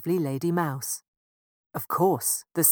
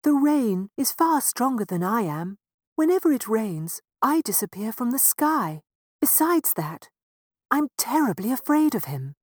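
higher, louder fearful sun; a lightly voiced, afraid sun; timid sun; 7 semitones higher, 5 dB louder than usual. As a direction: confess your fear of the rain. Sun confesses his fear.

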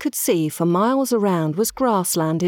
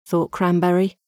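usual speaking voice

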